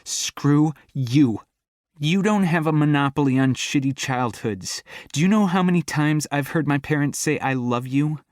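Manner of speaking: fighting back tears; bitter fury. Acted. Angry tearful confession.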